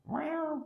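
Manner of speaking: very high, falling meow; squeaky mock meow; imitated cat meow. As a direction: mimic a cat's cry. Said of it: "like, meow".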